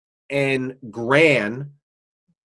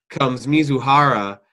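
normal talking voice

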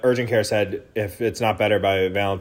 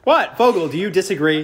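mock offended; 8 semitones higher, 5 dB louder than usual. Real, not acted. Mock offense at friend's laugh.

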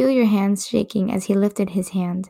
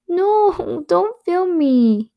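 high, slow squeaky protest; flustered protest; 10 semitones higher, much slower than usual. Acted. Bride hiding from the camera, high embarrassed squeal. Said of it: "you cried".

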